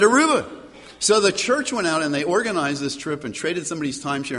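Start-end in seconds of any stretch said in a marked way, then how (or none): 0.0-0.4 Aruba said higher and louder, with a grin; emphatic Aruba. Retold or imitated. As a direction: pastor repeats his pick with a grin, Aruba said higher and louder. Repeating destination, emphatic.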